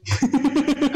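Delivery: bright burst of laughter; hearty laugh. Real, not acted. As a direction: laugh out loud, high. Laughing at funny memory.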